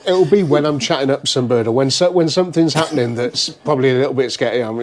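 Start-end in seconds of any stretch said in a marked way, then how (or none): none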